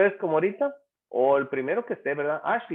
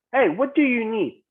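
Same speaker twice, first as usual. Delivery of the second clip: lightly acted, higher-pitched call to someone; acted question; 8 semitones higher, 4 dB louder than usual. Teacher plays someone asking a group, lightly acted question. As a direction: pitch it up as if asking another person. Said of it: "Y me responde".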